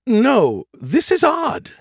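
AI ship voice. A narrator's reading. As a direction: voice the ship's computer lady. AI ship voice Nagatha.

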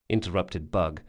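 usual speaking voice

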